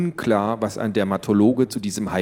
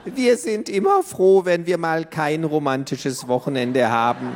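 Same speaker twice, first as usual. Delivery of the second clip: put-on old granny voice; 7 semitones higher than usual. Grandma voiced.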